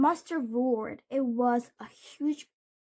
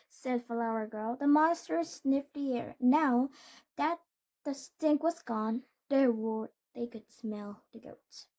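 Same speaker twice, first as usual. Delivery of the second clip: usual speaking voice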